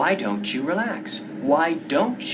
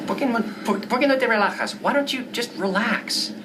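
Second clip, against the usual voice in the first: pestering friend; 3 semitones higher than usual. Acted. Friend urges stressed painter to relax. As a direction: nag her to relax.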